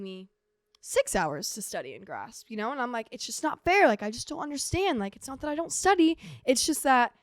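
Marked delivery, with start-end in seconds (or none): 3.1-7.1 higher-pitched, frustrated protest; retold exasperation, pitched up; exasperated complaint. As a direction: plead that it just isn't fair. Acted complaint about studying.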